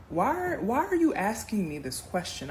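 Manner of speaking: disbelieving, pressing him; incredulous. Retold or imitated. Asking her date why, high incredulous question. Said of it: "I said to him".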